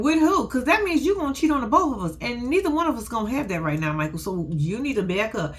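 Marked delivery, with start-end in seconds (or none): 0.0-0.5 incredulous question. Retold or imitated. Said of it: "And I'm saying".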